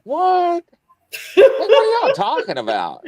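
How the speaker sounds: high, loud, laughing disbelief; incredulous. Retold or imitated. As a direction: act baffled, voice jumping up with a laugh. Confused by parents' wine accusation.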